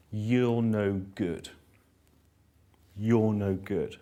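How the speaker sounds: slow, drawn-out put-down, lightly acted; a hint of a critical voice, very slow; critical inner voice. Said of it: "What's the critical voice saying?".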